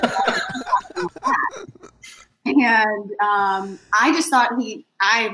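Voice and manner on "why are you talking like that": very high, hammed-up British accent mimicry; exaggerated British accent through laughter; mock British accent. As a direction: squeal it back in a silly British accent, laughing. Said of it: "speaking back to him in my British accent".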